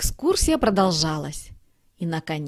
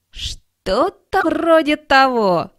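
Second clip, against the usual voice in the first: higher, louder, slow; group reply; 7 semitones higher, 7 dB louder, slower than usual. A narrator's reading. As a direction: kittens answer together. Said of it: "отозвались котята". (хором, выше и громче)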